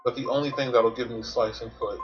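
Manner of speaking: ordinary talk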